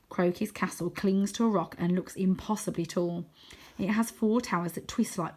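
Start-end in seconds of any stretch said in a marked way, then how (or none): none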